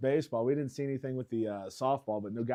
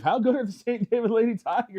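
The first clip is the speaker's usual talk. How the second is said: higher, louder, laughing through it; teasing Dave with a laughing, higher repeat; laughing tease; 9 semitones higher, 4 dB louder than usual. Acted. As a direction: needle your co-host with a giggle. Repeats question, teasing co-host.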